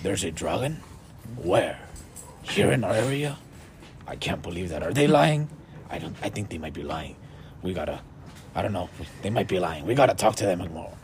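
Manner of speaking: a wary dwarf doubting the travelers, voiced low and hushed; dwarf doubting the strangers, low suspicious voice. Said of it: "He looks at his buddy and goes".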